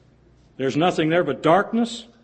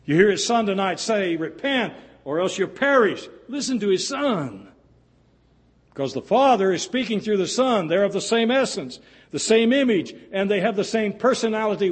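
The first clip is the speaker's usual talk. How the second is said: preacher urging the congregation to listen to the Son; preaching; 7 semitones higher than usual. Acted. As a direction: lift it up and drive the point home. Preaching rising again.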